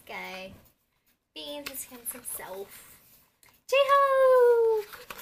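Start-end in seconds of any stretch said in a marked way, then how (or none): 3.7-5.1 higher and louder name cry; excited shout. Real, not acted. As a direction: shout his name with joy. Sees photocard, yells name.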